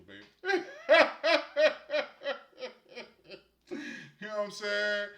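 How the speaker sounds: bursting into laughter on the word; teasing and cracking up; laughing. Real, not acted. Laughing while teasing listener.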